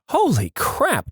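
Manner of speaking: high, drawn-out exclamation of alarm. Acted. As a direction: stretch it out high, as if jolted. Contrasts regular turbulence with scary turbulence.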